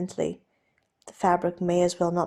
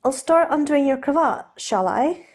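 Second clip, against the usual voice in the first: raised, lighter voice for the nervous aristocrat; Steed, flustered gentleman; 7 semitones higher, 5 dB louder than usual. Acted. Steed offering to undo Ed's cravat, nervously polite. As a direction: play Steed, polite and a little jittery.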